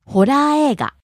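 ホラー映画 rising on ラ, falling on the second-to-last い; pitch accent demo. Shown as a demonstration. Teacher repeats vocabulary, ホラー映画 rising on ラ and falling on い. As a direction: raise ラ of ホラー映画, let the い of 映 fall. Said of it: "Rises on the second syllable ra and falls".

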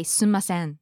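"sumasen" with the "mi" syllable dropped; shortened variant. Shown as a demonstration. Co-host showing what some people say, shortened pronunciation. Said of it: "some people might say".

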